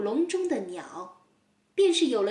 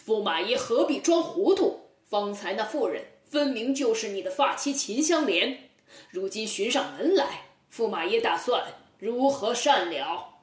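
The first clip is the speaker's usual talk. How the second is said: 冷笑. Acted. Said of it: "包公又是一声冷笑说".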